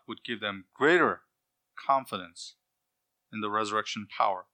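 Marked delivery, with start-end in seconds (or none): none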